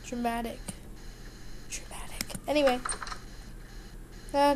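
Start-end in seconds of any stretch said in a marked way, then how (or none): 0.1-2.2 fading echo down to a whisper; soft, mock-dramatic echo; dramatic hush. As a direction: repeat it softer, ending in a whisper. Echoing her own word for effect.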